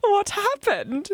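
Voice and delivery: high, slow, hammy British voice; posh British accent. Retold or imitated. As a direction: drawl it out like the queen. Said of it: "'cause I'm like".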